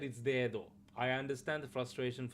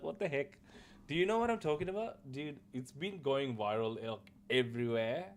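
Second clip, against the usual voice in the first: usual speaking voice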